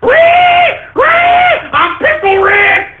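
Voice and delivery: mocking a fan's frantic shrieks; shrieking Pickle Rick. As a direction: scream it like an unhinged fan on a counter. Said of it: "He starts saying crap like".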